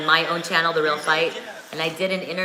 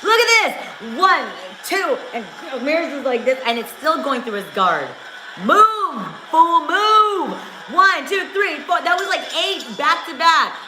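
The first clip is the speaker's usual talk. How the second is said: excited fight yelling; 7 semitones higher than usual. Real, not acted. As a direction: yell at the screen as the punches land. Shouting at the fight live.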